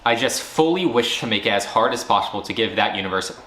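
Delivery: usual speaking voice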